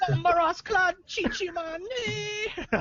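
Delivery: high, put-on Jamaican patois; Jamaican accent. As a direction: rattle off Jamaican-sounding slang. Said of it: "your Jamaican accent".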